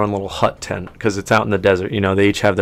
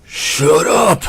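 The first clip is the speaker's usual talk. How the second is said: higher-pitched orc outburst; angry orc; 8 semitones higher, 4 dB louder than usual. Acted. Game master as an orc snapping at the elf, angry. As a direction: cut them off as an irate orc. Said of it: "and the orc goes".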